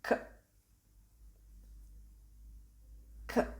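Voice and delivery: isolated K sound, as in English; K as a short, crisp /k/; demonstrated hard C. Shown as a demonstration. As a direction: say K as a bare hard consonant, not the letter name. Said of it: "the first pronunciation of this letter is".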